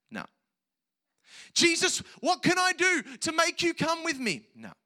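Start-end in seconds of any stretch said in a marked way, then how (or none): 1.5-4.4 high, loud begging shout; hammed-up pleading at full voice; mock desperate plea. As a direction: cry out in exaggerated desperation. Acting out a plea not made.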